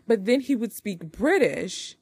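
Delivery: ordinary delivery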